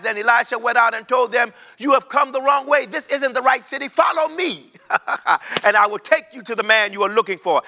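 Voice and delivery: high, ringing preacher's cadence; preaching at full force. Acted. Preacher tells the church the story, high and ringing. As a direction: preach it out, almost singing.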